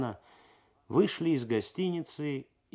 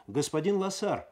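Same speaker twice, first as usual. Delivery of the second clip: raised, higher call to someone leaving; calling after him; 7 semitones higher, 3 dB louder than usual. A narrator's reading. Customs officer calling after the departing Losar, raised call. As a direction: shout after a man walking away. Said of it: "крикнул ему вдогонку таможенник".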